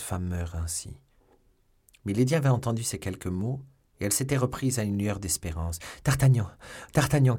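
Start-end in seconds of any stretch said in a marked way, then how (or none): 6.0-7.4 loud, higher pleading call; crying out. A narrator's reading. Condemned Milady calls to D'Artagnan for help, crying out. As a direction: call the name out urgently. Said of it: "cria-t-elle".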